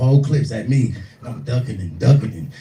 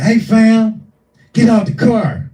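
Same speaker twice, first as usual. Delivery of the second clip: high, loud shouted command; retold yell; 11 semitones higher, 8 dB louder, slower than usual. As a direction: bark it out like an angry driver. Stand-up retelling driver yelling.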